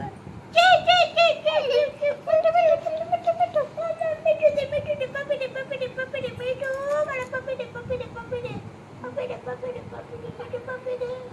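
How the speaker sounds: high, chattering parrot imitation. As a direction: squawk it high like a pet parrot, then settle into repeated chatter. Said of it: "मिठू कसा ओरडतो?".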